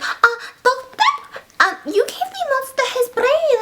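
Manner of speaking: very high, put-on squeaky voice; coy Inga voice. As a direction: ask the doctor in a tiny high voice. Inga questions doctor, put-on voice.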